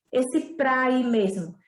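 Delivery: ordinary talk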